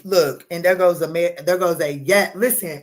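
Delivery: ordinary talk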